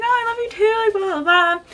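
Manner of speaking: high, sweet light reply. Retold or imitated. [name] answering the fan, sweetly imitated. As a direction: coo it back sweetly. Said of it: "She's like".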